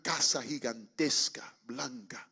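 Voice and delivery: breath-only, slowed reveal; hushed awe. Retold or imitated. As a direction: whisper it as a wondrous sight far off. Awed reveal of the distant house.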